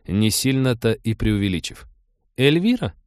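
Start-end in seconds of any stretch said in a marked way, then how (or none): 2.4-2.9 high, questioning, knowing; understanding, higher-pitched check; knowing query. A narrator's reading. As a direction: ask it as if you already understand. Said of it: "понимающе уточнила Трикси".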